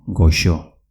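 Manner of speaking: "goxo", sounding like gosho. Shown as a demonstration. Teacher models the Basque word for sweet, x as sh. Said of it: "con una X".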